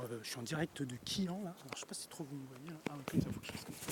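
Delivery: whispering to the phone at night; low hushed whisper at night. Real, not acted. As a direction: keep it hushed, almost whispered.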